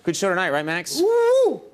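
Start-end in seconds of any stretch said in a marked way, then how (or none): 1.0-1.6 loud high mocking woo; host mocking a fan's woo. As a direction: imitate the badly timed audience woo. Mocking audience woo again.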